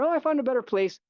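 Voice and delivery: high-pitched breezy retold answer; retold casual reply. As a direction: imitate yourself answering a neighbor lightly. Retells own answer to the neighbor.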